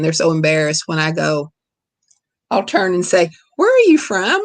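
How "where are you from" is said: much higher and louder, bright eager question; eager call out. Retold or imitated. Mom calling to a stranger at the doctor's office, eager and high. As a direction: call out to a stranger you just recognized.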